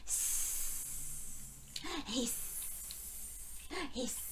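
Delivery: soft whispered snake hissing. Shown as a demonstration. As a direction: hiss like a snake for the children. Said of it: "Can you be a snake for me".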